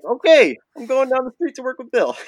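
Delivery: very high, squeaky falsetto reply; mock chirpy compliance. Young rigger obeys being sent off to Bill, mock chirpy falsetto.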